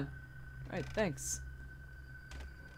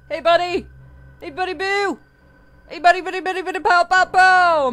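playful call; 6 semitones higher, 7 dB louder, faster than usual. Acted. Calling out to animatronic playfully.

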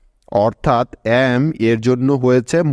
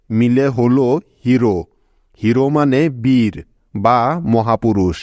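usual speaking voice

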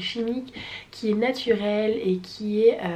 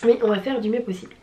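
ordinary delivery

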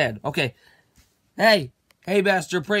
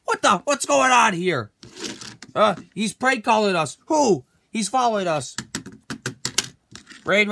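ordinary delivery

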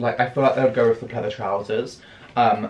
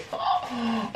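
soft gasp; 10 semitones higher, 7 dB quieter than usual. Real, not acted. Touching the jumper.

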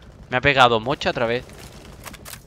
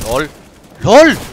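excited shout; 4 semitones higher, 12 dB louder than usual. Real, not acted. Excited outburst at funny kill.

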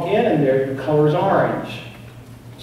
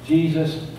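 usual speaking voice

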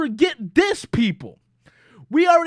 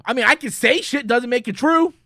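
normal talking voice

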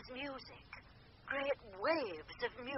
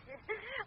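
soft high giggle; 10 semitones higher, 6 dB quieter than usual. Acted. Medium laughs at spirit's excitement.

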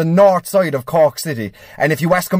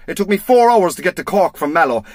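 usual speaking voice

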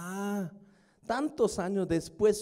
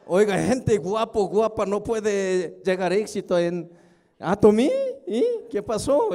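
mock complaint; 2 semitones higher, 7 dB louder than usual. Speaker pretends to complain to the vice president, mock indignant. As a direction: pretend to confront the boss, hammed up. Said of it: "Voy a reclamar al vicepresidente".

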